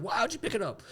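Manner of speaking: high, softened mock scream; retold screaming. Golfers scream at the flag taker, high softened imitation. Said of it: "We're screaming".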